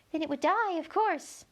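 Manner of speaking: high, thin little insect voice; a tiny gnat piping up to Alice. A narrator's reading. Gnat telling Alice where to look, high and thin.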